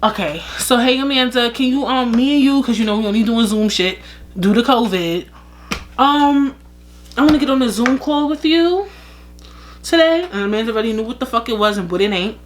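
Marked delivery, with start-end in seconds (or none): 0.0-10.3 overly sweet, higher fake-nice manager voice; mock corporate boss. Show host boss luring Amanda to a Zoom call, fake-nice. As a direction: play the fake-friendly boss setting up the call. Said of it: "bitch, they said".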